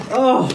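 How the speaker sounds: high strained cries of pain; pained gasps. Real, not acted. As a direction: gasp through the burn. Throat burning from spice.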